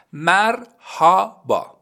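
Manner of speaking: slow drawn-out Turkish merhaba; Turkish word demo. Shown as a demonstration. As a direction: say merhaba slowly as a Turkish model. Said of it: "اولین کلمه است".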